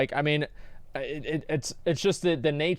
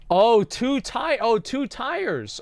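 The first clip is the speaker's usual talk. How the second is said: high, slow, drawn-out "oh"; slow mock-amazed groaner reaction; dawning realization; 8 semitones higher, 4 dB louder, much slower than usual. Real, not acted. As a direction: stretch it out as the joke lands. Reacting to a pun joke.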